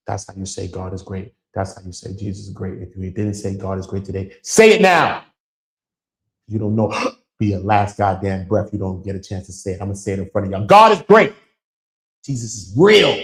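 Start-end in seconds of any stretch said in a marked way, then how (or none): 4.4-5.2 "say it now" shouted high; urgent shout. Real, not acted. Urging his chat to praise God, urgent shout. 10.6-13.1 much higher and louder proclamation; fervent shout. Real, not acted. Declaring his faith in front of viewers, fervent shout.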